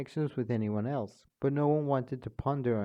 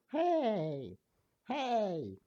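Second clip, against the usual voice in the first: a higher, offended protest; object, hurt and high; indignant hey; 8 semitones higher than usual. Acted. The mocked character protesting, high.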